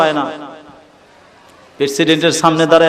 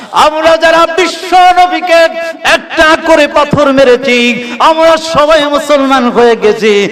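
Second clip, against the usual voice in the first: high, ringing chanted exhortation; fervent sermon cry; impassioned preaching; 10 semitones higher, 3 dB louder than usual. Real, not acted. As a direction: rouse the congregation on a high sustained pitch.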